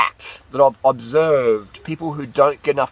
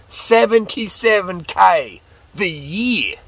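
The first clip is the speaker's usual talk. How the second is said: "Seventy-seven K the year" slowed and pitched higher; emphatic repeat; 5 semitones higher, slower than usual. Real, not acted. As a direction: spell out "Seventy-seven K the year" slowly, each word weighted.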